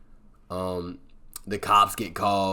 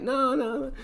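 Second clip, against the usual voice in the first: much higher squeaky no; refusing. Retold or imitated. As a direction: turn him down in a high squeak. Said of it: "she's like".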